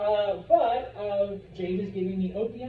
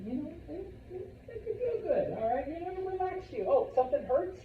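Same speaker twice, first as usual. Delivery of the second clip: high coaxing pitch; 10 semitones higher than usual. Retold or imitated. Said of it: "the British go to China".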